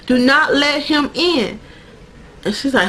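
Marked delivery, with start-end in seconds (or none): 0.1-1.6 slow, deliberate, higher-pitched acted command; solemn warning. Lady in the coma dream warns Sookie, solemn warning. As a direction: warn her slowly, word by word.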